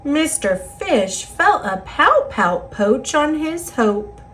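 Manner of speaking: ordinary delivery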